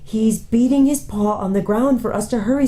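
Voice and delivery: Lucy pleading to hurry; urgent girl. Acted. Young girl urging her siblings to hurry, earnest.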